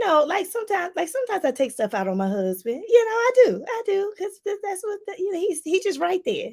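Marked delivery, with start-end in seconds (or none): 2.9-6.5 laughing confession. Real, not acted. Admits taking stuff out on husband.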